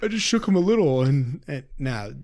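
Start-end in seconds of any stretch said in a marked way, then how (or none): 0.0-1.0 quick, high, pleading excuse; mock defensive excuse. Friend mocking a defendant's excuse, quick and high. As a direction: play the innocent shaker, fast and high. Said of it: "A lot of them are like".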